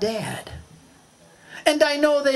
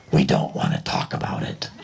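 hushed refusal. Acted. Raft people refuse to discuss ship.

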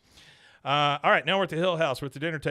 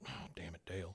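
low, much softer annoyed grumble under the breath; muttered grumble; 6 semitones lower, 15 dB quieter than usual. Retold or imitated. Hank grumbling at Dale over the truck sticker, soft and low.

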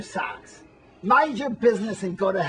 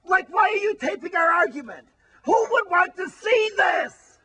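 indignant shout; 7 semitones higher than usual. Acted. Wally protests the camera.

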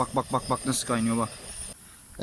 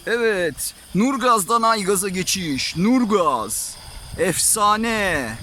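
hyped announcer; 4 semitones higher, 7 dB louder, much slower than usual. Acted. Hyped product shout-out.

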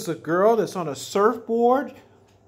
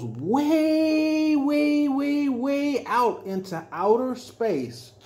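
higher, slow stretched way way way; stretched out rising; 7 semitones higher, much slower than usual. Pretend. Telling a child about the rocket, higher and drawn out. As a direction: draw it out as the rocket soars off.

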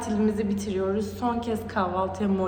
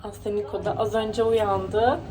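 normal talking voice

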